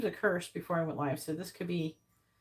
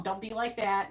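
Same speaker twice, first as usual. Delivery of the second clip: usual speaking voice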